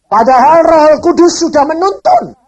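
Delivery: loud, high-pitched preaching cry; loud revival preacher exclaiming; 1 full-force preaching. Real, not acted. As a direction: hammer it home to the congregation.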